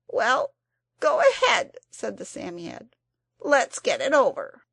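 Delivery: high, loud grudging creature; resigned Psammead. A narrator's reading. The Psammead grudgingly lets the children wish. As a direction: get it over with, grudgingly.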